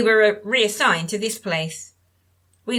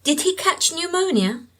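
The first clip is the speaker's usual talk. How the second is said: put-on higher, light young woman's voice; Sandra's voice; 9 semitones higher than usual. A narrator's reading. Sandra tries again.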